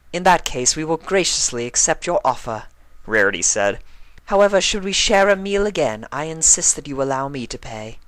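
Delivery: high, refined lady voice; gracefully accepting the offer; Rarity's gracious voice. A narrator's reading.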